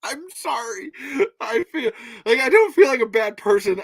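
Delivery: high, squeaky laughed-out apology; recovering from laughter. Real, not acted. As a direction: apologise while still cracking up. Apologising after laughing fit.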